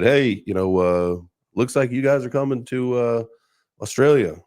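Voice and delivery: half-hearted retold holler; retold yell. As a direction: call upstairs-to-downstairs, toned down for retelling. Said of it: "I kinda yelled from upstairs".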